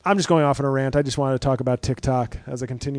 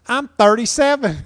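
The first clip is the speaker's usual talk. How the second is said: much higher, louder proud reveal; TikTok woman voice; 9 semitones higher, 6 dB louder than usual. Retold or imitated. Comedian voices a TikTok mom proudly revealing her age. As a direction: announce your age like a triumph. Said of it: "my TikTok woman voice".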